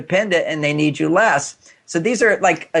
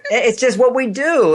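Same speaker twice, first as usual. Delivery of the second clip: laughing; 7 semitones higher than usual. Real, not acted. Laughing after host's embarrassed remark.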